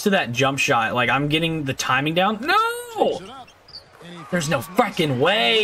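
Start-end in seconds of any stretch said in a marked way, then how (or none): 2.4-3.2 high yelp of protest, much higher; sudden shriek of disbelief at the game; outraged cry. Real, not acted. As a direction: cry out no in dismay. Shot goes wrong in game.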